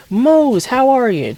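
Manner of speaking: loud, high hearty hello; hearty greeting. Acted. Stepdad greets Dad across the room, loud and high. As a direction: call out warmly to an old friend.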